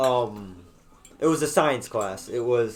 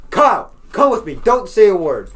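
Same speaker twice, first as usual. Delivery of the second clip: loud, raised command; stern principal; 4 semitones higher, 7 dB louder than usual. Retold or imitated. Principal orders student to come along, stern raised voice. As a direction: order the student out firmly and loudly. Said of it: "the principal says".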